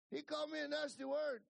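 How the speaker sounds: very high, loud character voice; indignant complaint, imitated. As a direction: play an offended ranch hand protesting. Alvino's heated complaint in story.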